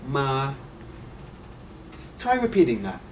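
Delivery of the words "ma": "ma" held long on a level pitch; first tone. Shown as a demonstration. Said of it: "The first tone is".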